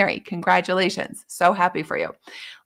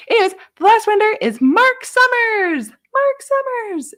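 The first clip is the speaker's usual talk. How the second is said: much higher and louder, drawn-out name; excited reveal; 7 dB louder than usual. Real, not acted. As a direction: announce the last winner with a flourish. Final winner reveal.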